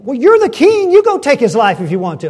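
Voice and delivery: high, forceful challenge to a king; loud retort. Acted. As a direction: throw it back at him, loud and quick. Acted retort urging the king to act.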